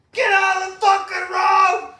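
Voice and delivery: high, loud, slow yelled order; hammed-up furious yell; mock sheriff shout. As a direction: bellow it like an angry cop. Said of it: "You're out here telling me".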